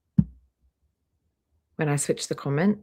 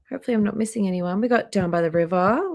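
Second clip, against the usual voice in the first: normal talking voice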